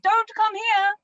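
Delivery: high, urgent mock call; retold yell. Telling someone to stay away from her bathroom spot, mock yelling. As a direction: imitate yelling a warning, pitched well up. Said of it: "And I yelled like that".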